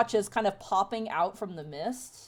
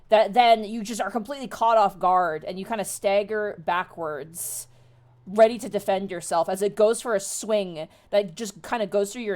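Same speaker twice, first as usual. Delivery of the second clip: loud, dramatic scene setting; suspenseful tabletop storytelling, loud at each reveal; game master narration; 3 semitones higher, 7 dB louder than usual. Acted. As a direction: build the monster's attack, then ease off as it fades.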